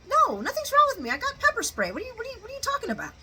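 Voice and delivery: very high, put-on protesting voice; hammed-up defensive squeal, speeding up at the end; mock flustered denial. Chase denying he was crying, high flustered mock denial.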